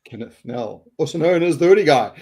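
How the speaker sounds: usual speaking voice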